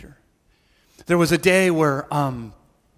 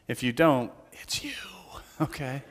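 whispered aside; 5 semitones lower, 9 dB quieter than usual. Real, not acted. Joking aside to congregation.

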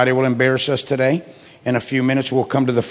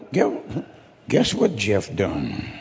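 slow, low, hushed gossip; 5 semitones lower, 5 dB quieter, slower than usual. Retold or imitated. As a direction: draw it out like a juicy secret. Gossip spreading after service.

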